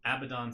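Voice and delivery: Abaddon said as "AB-uh-don"; incorrect AB-a-don pronunciation. Shown as a demonstration. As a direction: say Abaddon with stress on AB. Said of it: "sounds better. I know it's incorrect".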